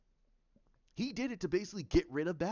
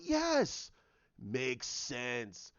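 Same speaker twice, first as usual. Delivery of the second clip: thrilled viewer reaction; 6 semitones lower, much slower than usual. Acted.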